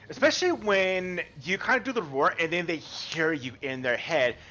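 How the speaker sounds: ordinary talk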